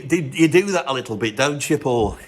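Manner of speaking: ordinary talk, nothing marked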